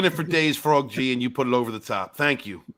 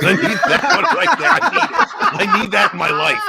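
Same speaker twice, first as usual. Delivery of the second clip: excited; 9 semitones higher, 6 dB louder than usual. Real, not acted. Excited laughing plea.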